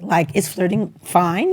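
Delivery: ordinary talk, nothing marked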